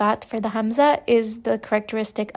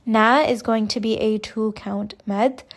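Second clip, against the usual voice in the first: usual speaking voice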